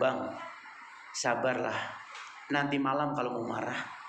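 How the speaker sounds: gentle wife. Pretend. Wife soothing her angry husband in front of the children, softly coaxing. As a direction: coax him calm like a patient wife.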